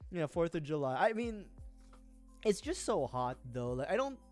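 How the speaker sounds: ordinary talk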